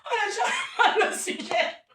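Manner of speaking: high, slow, laughing tease; mock it, cracking up; laughing singsong. Real, not acted. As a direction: sing out the phrase while laughing. Teasing repeated catchphrase.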